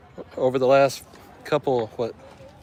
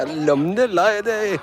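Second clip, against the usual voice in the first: imitating her words; 7 semitones higher, slower than usual. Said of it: "I don't know what she's saying".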